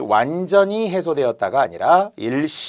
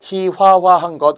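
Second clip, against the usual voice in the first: ordinary talk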